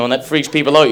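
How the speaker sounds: ordinary delivery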